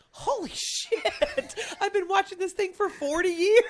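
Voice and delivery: high, loud shocked outburst, laughing; retold shock. Retelling her own shock at missing the dentist's ears. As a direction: blurt it out in disbelief. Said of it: "And I said".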